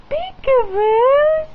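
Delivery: bright burst of peekaboo; excited peekaboo. Real, not acted. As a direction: pop out with a delighted peekaboo. Peekaboo reveal to baby.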